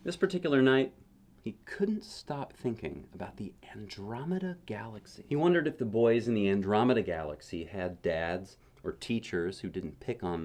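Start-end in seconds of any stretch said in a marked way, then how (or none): 1.4-5.2 hushed. A narrator's reading. Bedtime narration turns hushed.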